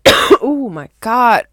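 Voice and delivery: choking out words between coughs; strained through a coughing fit. Real, not acted. Coughing fit mid-sentence.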